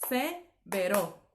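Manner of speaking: slow "severo" split as se, pause, vero; two chunks in "severo", se - vero; división incorrecta. Shown as a demonstration. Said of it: "No es así". ("severo" partido en se - vero)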